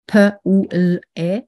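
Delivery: "P ou L E" as four separate phonemes; sound by sound. Shown as a demonstration. Teacher segmenting poulet into sounds, said sound by sound. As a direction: sound out "P ou L E" one by one, pausing between. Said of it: "On peut diviser des mots en sons".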